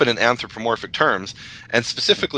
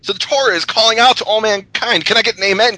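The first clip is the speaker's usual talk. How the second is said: higher-pitched call for an amen; preacher's call; 9 semitones higher than usual. Acted. Host rallying fellow guests for an amen, preaching. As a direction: rouse the congregation to respond.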